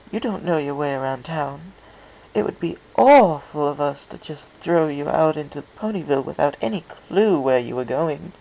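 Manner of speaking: Rarity explaining where Fluttershy lives, high and refined; refined pony lady. A narrator's reading. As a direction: voice an elegant, polite lady. Rarity voiced by narrator.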